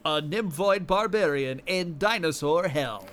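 put-on slow, booming old radio announcer; 1950s narrator. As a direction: read it like a forties radio announcer. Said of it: "Here's my take on the announcer guy".

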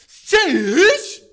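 high, loud "sheesh"; loud exclamation. Real, not acted. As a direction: blurt it out in disbelief. Exclaiming about the heat.

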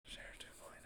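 hushed, voiceless whisper; whispered echo. Pretend. Co-host echoes the joke about [name], whispering. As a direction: whisper it back as a playful echo.

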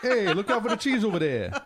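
laughing mock British accent; a playful British town crier. Host repeating the crier's warning in a British accent. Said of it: "That's my British accent".

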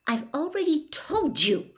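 high, indignant retort; very angry. A narrator's reading. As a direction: snap it back, pitched well up. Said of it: "very angrily".

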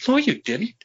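high-pitched retold contradiction; adamant. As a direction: push back insistently, voice jumping up, imitating the bill collector. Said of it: "he was just adamant".

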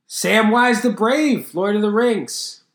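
drawn-out shout of Samwise the Brave; grand announcement. Real, not acted. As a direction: announce the hero like a herald. Hailing a favourite sidekick.

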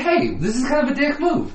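very high laughing complaint; mock whiny complaint. Russia to America, high whiny complaint. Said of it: "it's like".

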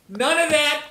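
usual speaking voice

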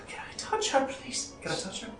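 put-on high, hushed girlish plea; small, high pleading voice; eager young woman. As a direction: ask softly, like an adoring young fan. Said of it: "younger woman. She's probably in, like, her nineteen".

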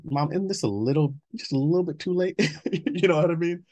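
high, amused teasing voice; laughing retort. Retold or imitated. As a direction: tease mom with a laugh. Retold joke to mom, laughing.

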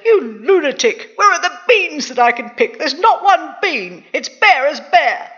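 high, loud, exasperated mother; furious scolding. A narrator's reading. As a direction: tear into the foolish boy.

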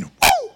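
short, high, loud whoo; amazed whoop. Acted. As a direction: let out an impressed whoop. Sick man amazed at Faith.

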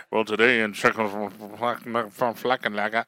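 slow, put-on anchorman voice; mock newscaster. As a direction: parody a stiff TV news anchor. Mock newsreader opening.

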